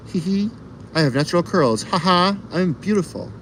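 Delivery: high giggly self-praise; mock vain. Bragging to chat about her curls, high coy giggle. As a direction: preen playfully, high and coy.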